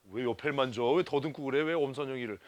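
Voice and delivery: usual speaking voice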